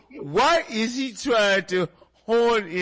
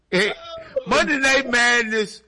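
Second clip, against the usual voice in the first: usual speaking voice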